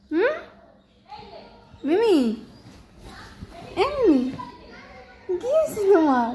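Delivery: high sing-song calling; pet talk. Real, not acted. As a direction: call her gently out of hiding.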